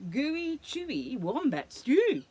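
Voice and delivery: loud, slow boast; a touch of boasting. Acted. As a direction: brag about your stew. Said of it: "boasted Dingo".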